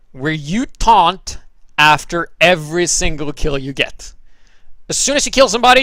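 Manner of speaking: ordinary talk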